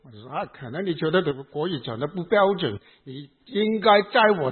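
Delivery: ordinary talk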